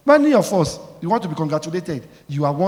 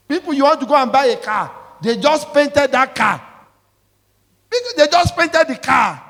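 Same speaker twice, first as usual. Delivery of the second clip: rising shout; 8 semitones higher, 6 dB louder than usual. Real, not acted. Preacher shouting a warning about painted cars.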